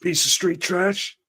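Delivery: movie quote voice. Retold or imitated. Quoting a film character.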